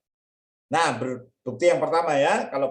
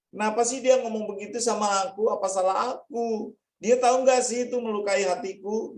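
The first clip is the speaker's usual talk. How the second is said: put-on hurt child's thoughts; 9 semitones higher than usual.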